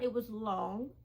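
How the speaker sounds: normal talking voice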